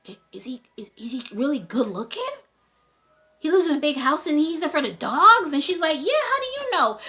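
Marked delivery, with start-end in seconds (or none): 0.1-5.5 growing alarm. Retold or imitated. Cellmate realizing the danger. 6.0-7.0 surprised question. Retold or imitated. Woman startled that her cellmate knows.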